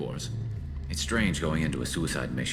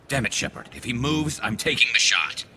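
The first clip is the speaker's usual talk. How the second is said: raised, much higher angry voice; furious warning, louder at the end; angry threat; 9 semitones higher, 4 dB louder than usual. Acted. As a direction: build to a loud final threat. Sniper threatens to fire.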